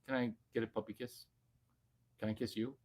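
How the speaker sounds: whisper-soft ask for a kiss; soft coaxing. Real, not acted. Asking his dog for a kiss, very soft and quick.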